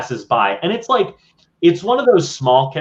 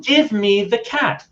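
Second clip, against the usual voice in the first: put-on high whiny child's voice, slowing on the demands; spoiled little boy; 10 semitones higher than usual. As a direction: play a bratty kid demanding a pet from daddy. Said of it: "the small child says".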